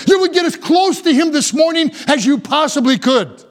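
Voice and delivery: high-pitched, urgent sermon plea; fervent preacher's appeal; impassioned preaching. Real, not acted. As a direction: plead with the congregation, pitch held high.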